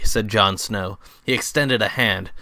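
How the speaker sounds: ordinary delivery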